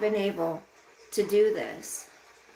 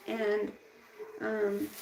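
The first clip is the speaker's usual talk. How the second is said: ordinary talk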